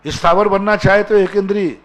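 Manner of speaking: high, loud rhetorical challenge; challenging question. Real, not acted. Preacher loudly questioning the congregation.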